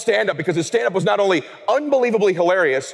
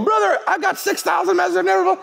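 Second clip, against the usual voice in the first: excited. Retold or imitated. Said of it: "he's in his kitchen on Tuesday going".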